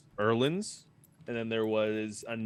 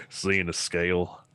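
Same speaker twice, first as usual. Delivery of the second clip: normal talking voice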